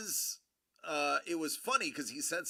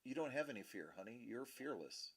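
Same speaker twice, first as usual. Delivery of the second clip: much softer, lower aside; 5 semitones lower, 15 dB quieter than usual. Real, not acted. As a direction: gently reassure your little one. Turns aside to reassure child.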